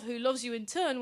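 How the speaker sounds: usual speaking voice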